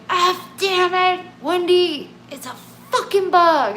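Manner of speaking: loud, high, furious yelling; dad's angry yell. Retold or imitated. Dad yells at his daughter over a dead moth, loud furious yell. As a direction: bark it at her like an angry dad. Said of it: "yelling at me".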